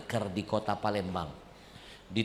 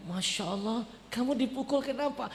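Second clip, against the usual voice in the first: shocked mother; 10 semitones higher than usual. Retold or imitated. Mother shocked her son was hit. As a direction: gasp like a worried mother.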